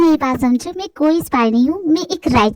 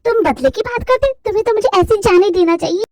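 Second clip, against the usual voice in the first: ordinary talk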